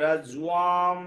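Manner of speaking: রাজ্যুয়াম with a "jua" glide and held ending; pronunciation model. Shown as a demonstration. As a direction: stretch the last syllable of রাজ্যুয়াম. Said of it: "জয় জফলা আছে".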